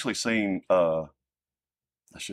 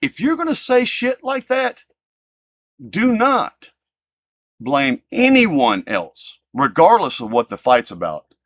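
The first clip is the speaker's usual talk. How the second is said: angry warning; 7 semitones higher, 6 dB louder, slower than usual. Real, not acted. Warning hateful commenters.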